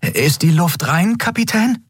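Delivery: put-on cautious young underling. A narrator's reading. Miko asking the captain from cover, high and cautious. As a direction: ask from hiding, pitch climbing to the end.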